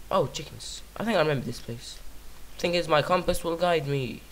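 ordinary delivery

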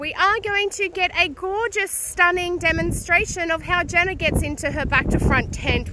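loud, high show-host voice; hammy presenter. Acted. Hammed-up presenter intro.

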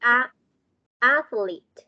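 "athlete" with a clear first "A"; corrected pronunciation. Shown as a demonstration. Teacher correcting a pupil's "athlete", clear pronunciation model. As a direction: model "athlete", leaning on the opening "A". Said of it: "No, no".

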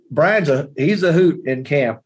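normal talking voice

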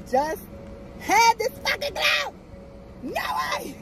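very high, incredulous cry; disbelieving squeal. Real, not acted. Driver who just had this glove, disbelieving squeal.

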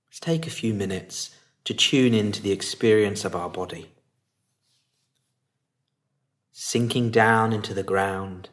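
calm, unhurried meditation lead with long pauses; guided meditation. Acted. Teacher settling listeners into meditation posture and body, calm guiding voice.

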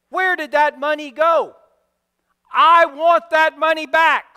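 loud, sharp royal demand; demanding king. Acted. King demanding his money from the servant, loud. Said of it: "and demands".

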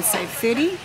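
usual speaking voice

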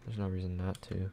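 ordinary delivery

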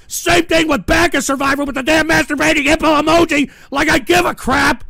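irritated, strained yelling; exasperated rant. Real, not acted. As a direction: complain loudly, at wits' end. Ranting at donors' emojis.

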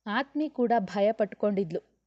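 plain reading voice

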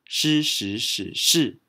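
slow drill of shi through the four tones; 慢读四个声调的shi. Shown as a demonstration. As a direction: sound out shi in each tone slowly, 是时始是. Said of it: "This character is pronounced as".

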